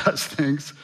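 ordinary talk

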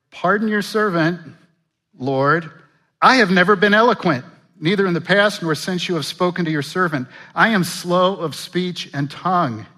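pleading Moses. Acted. Moses pleading in read scripture.

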